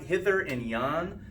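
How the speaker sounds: usual speaking voice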